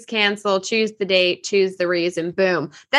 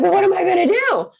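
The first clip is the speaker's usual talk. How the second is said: much higher and louder, whiny panic; a kid suddenly worried; mimicking stepson; 11 semitones higher, 4 dB louder, faster than usual. Stepson realizing the old policy is not cancelled, whiny.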